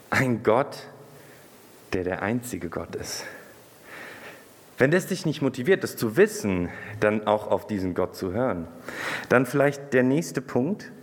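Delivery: normal talking voice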